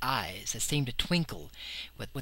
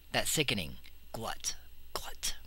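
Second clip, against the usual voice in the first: whispered, softer, with dread; horrified whisper; 5 semitones lower, 10 dB quieter than usual. A narrator's reading. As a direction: let the sound die in a whisper. The monster eating again.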